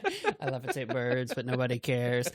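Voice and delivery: Stephen Wright. Retold or imitated. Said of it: "sounds like he doesn't even care".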